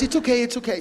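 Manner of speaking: high, light, laughing it off; amused reassurance. Retold or imitated. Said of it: "She was laughing".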